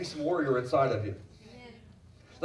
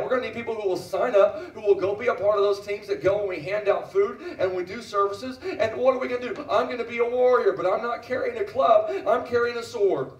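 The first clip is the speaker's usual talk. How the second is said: higher, driving call to action; rallying preacher; 5 semitones higher, 3 dB louder than usual. Real, not acted. Preacher recruiting volunteers as warriors, fervent rallying call. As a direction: rouse the crowd, pressing harder phrase by phrase.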